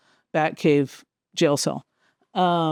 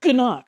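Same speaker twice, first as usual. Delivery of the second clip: higher-pitched comic punch noise; imitated punch sound; 7 semitones higher, 4 dB louder than usual. Showing the sound a punch makes, punchy imitated noise. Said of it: "That's the sound that a punch makes".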